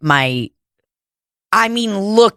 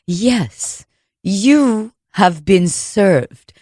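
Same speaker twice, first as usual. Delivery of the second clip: slow, deliberate official delivery; choicely spoken process server; much slower than usual. Acted. Process server confirming a name, slow and choice. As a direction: place each word choicely, at half speed. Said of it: "They use their words very choicely".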